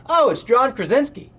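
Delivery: high, loud excited blurt of a name. Retold or imitated. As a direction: shout it so the whole room hears. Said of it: "As loud as he could have said it".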